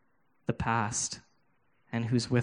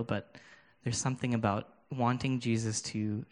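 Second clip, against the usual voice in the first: soft, unhurried prayer over the room; quiet prayer; 4 dB quieter than usual. Real, not acted. Preacher praying softly over his hurting listeners, hushed prayer. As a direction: pray gently, inviting the Spirit in.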